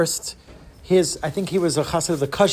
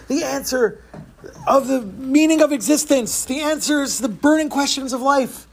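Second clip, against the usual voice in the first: desperate pleading; 8 semitones higher than usual. Acted. Man pleads desperately with gabbai.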